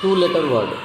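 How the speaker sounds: ordinary talk, nothing marked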